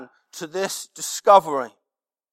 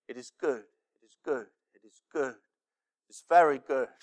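God's voice; 6 semitones lower, 5 dB quieter, faster than usual. Acted. God's voice quoted, low and soft.

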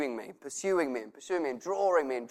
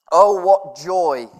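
loud, slow, exultant; joyful proclamation; 4 semitones higher, 11 dB louder, much slower than usual. Shown as a demonstration. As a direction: proclaim the joy fully. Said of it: "different version of it".

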